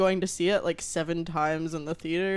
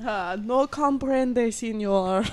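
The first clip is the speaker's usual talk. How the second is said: mock Spanish accent; 3 semitones higher, much slower than usual. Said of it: "switching accents all night".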